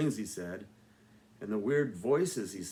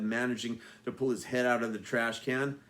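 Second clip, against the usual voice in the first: usual speaking voice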